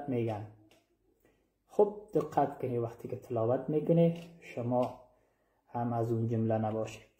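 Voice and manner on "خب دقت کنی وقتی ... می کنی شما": normal talking voice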